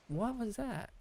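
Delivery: startled. Real, not acted. Startled by something sneaking up.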